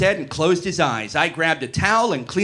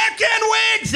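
very high ecstatic shout; climactic yell; slower than usual. Acted. Brian yelling in his lover's ear, high climactic shout. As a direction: yell it out at the peak, pitched way up. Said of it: "and yelled in my ear".

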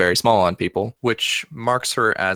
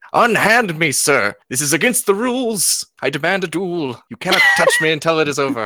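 higher, louder huffy noble; cartoonish indignation; 8 semitones higher, 3 dB louder than usual. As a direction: splutter like an offended cartoon fop. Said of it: "twisting into this cartoonish frown".

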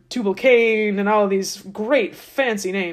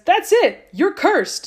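much higher, louder mock-furious verdict; hammed-up divine anger; mad God; 11 semitones higher, 5 dB louder, slower than usual. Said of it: "God's mad, and he says".

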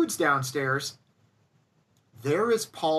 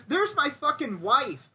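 ordinary talk, nothing marked

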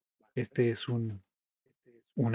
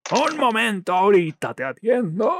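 shouting blacksmith; 9 semitones higher, 11 dB louder than usual. Acted. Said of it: "Ay, no habla como norteño".

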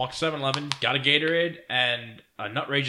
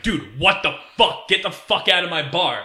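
louder, higher, drawn-out mock outrage; retold angry bouncer; 5 semitones higher, 5 dB louder than usual. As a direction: imitate an exasperated bouncer throwing someone out. Angry bouncer throwing speaker out.

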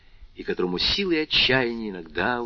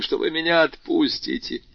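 ordinary delivery

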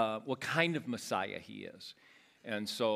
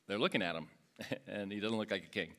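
ordinary talk, nothing marked

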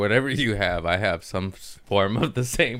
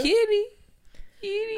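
high kitty call; 3 dB quieter than usual. Real, not acted. Calling the cat.

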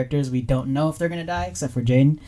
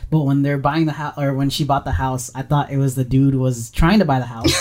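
usual speaking voice

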